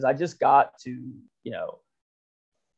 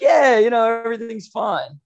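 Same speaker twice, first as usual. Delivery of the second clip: mock breezy reply; 8 semitones higher, 5 dB louder than usual. Stubborn kid answering mom.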